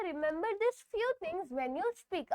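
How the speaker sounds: reads each English word slowly and clearly; slow dictation voice; slow textbook reading. Shown as a demonstration. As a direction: dictate the line slowly to the class. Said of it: "text book में क्या दिया गया है".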